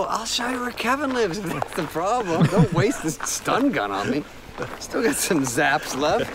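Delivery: high, slowing wheedle selling out a friend; hammed-up pleading; mock pleading. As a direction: bargain your way out. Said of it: "I go".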